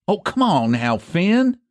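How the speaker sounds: mild pleading, voice lifting on the name; lightly worried old friend; anxiously. A narrator's reading. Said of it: "he said anxiously".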